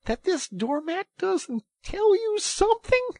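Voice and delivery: very high, loud incredulity; excited. A narrator's reading. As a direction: plead with someone missing the obvious. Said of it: "cried the excited rat".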